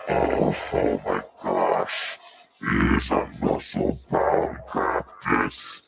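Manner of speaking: low slow strongman voice; deep muscle voice. Acted. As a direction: voice the beefy cactus low and slow.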